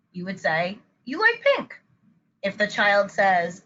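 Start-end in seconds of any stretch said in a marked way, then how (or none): 1.0-1.8 much higher, lilting echo that falls at the end; parent's reflection. Shown as a demonstration. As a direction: repeat it back brightly to a child. Parent reflecting back child's statement.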